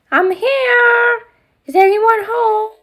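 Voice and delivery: put-on high, loud girlish call into the castle; Ariel calling out. Ariel doll calling into the empty castle, high and loud. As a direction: play the mermaid doll arriving and calling.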